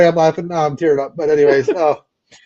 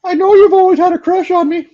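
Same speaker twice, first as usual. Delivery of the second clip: high, coy Miss Piggy swoon; 12 semitones higher than usual. Acted. Hosts joking on a stream, Miss Piggy voice. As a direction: flirt like Miss Piggy, pitched way up. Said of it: "As Miss Piggy".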